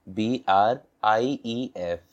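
"एफ़ आई आर एम" spelled slowly, letter by letter; slow spelling. Shown as a demonstration. Teacher spelling for learners, slow letter by letter. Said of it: "brief".

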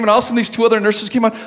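ordinary talk

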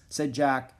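ordinary delivery

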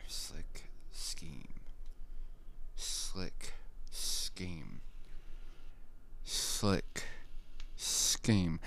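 ventriloquist style, lips still; lips barely moving. Shown as a demonstration. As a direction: say it without moving your lips. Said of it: "trying to say that without moving my lips at all".

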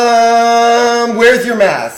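mock a hipster scolding a customer; mimicked scolding busybody. Mimics a scolding hipster cafe worker.

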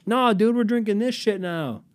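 rowdy high-pitched bro; insistent hyped friend. Acted. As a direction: excitedly overrule your buddy. Acted friend overruling the objection.

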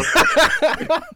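high, open burst of laughter; real laughter. Real, not acted. Amused voter reacting to the picture, high laughter.

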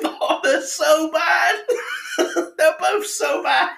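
high, loud laughing groan; laughing in disgust. Real, not acted. Tasting awful beer.

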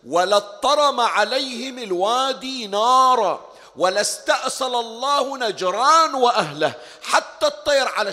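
loud and slow, pressing each word of the curse; full-force preaching. Acted. Preacher declaims the Prophet's curse on Najran, slow and booming. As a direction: drag out the threat like a sentence of doom.

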